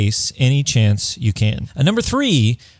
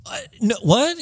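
baffled; 10 semitones higher, 4 dB quieter than usual. Retold or imitated. Other person confused reply.

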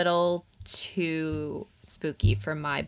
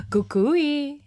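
spooky warning; 8 semitones higher than usual. Retold or imitated. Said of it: "they tell kids".

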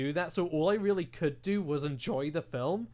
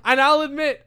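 laughing; 8 semitones higher, 9 dB louder than usual. Real, not acted. Laughing confession.

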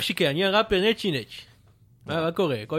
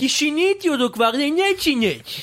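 heated argument; 4 semitones higher than usual. Acted. Fake rapper insulting the host in a heated argument. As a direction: snap back at him, offended.

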